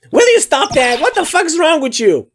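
ordinary talk, nothing marked

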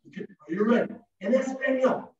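ordinary talk, nothing marked

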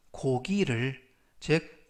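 ordinary talk

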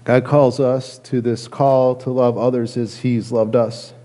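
ordinary talk

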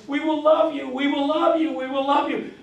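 high, loud chanted yell; crowd yell. Retold or imitated. Marchers yelling at police. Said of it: "we're yelling at the end".

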